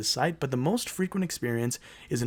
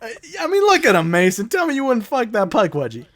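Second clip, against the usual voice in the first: teasing excitement; 8 semitones higher, 5 dB louder than usual. Real, not acted. Teasing friend about the creature.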